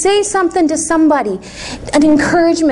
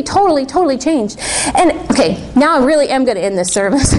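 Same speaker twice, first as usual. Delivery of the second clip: ordinary talk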